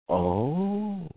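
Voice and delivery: soft, high, drawn-out rising oh; dawning realization. Retold or imitated. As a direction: act out the penny slowly dropping. Monk's slow realization acted out.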